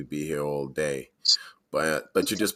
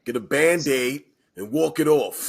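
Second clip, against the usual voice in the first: loud, higher-pitched playful order; hammed-up dismissive yell; mock tough-love shout; 8 semitones higher, 5 dB louder than usual. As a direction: holler it like an unsympathetic coach. Co-host joins the mock scolding.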